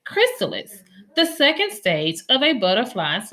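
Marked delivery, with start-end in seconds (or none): 0.1-0.8 Chrysalis lifted high and set apart as a headword; vocabulary word announced. Shown as a demonstration. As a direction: present Chrysalis like a teacher. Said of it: "Look at these words we can learn".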